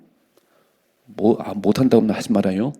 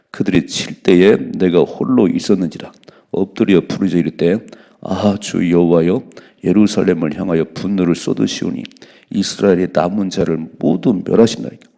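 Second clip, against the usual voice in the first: scripture reading; 2 semitones lower, 5 dB louder than usual. Acted. Preacher reading the verse loudly to his congregation.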